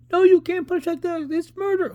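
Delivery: very high, loud squeal; shrieked protest. Acted. Character protesting the murder, high mock shriek. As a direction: squeak it out in a piercing mock panic. Acted protest in comic dialogue.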